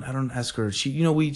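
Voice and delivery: ordinary talk, nothing marked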